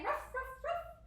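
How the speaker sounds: high-pitched little ruffs; dog bark. Shown as a demonstration. Teacher lifting into down dog, high playful barks. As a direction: yap high like a small dog. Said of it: "down dog and say".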